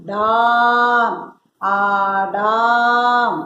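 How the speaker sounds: ഡാം and ആടാം with a slow, stretched daam; drawn-out long vowel. Shown as a demonstration. Teacher modelling the long vowel to pupils, drawn out. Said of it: "നീട്ടി പറയും".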